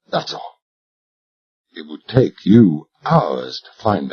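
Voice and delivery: low, soft, unhurried menace; cold quiet captor. Acted. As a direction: taunt him softly and calmly. Narrator voices cold captor.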